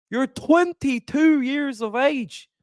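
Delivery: slow, drawn-out attempt at an Irish accent; a weak stab at a Conor McGregor Irish brogue; hammed-up Irish accent, barely there. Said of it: "I can't do an Irish accent, Conor McGregor".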